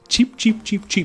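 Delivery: high little bird chirps; baby chick peeps. Retold or imitated. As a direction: peep like a tiny chick. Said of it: "James's taste is like a small bird".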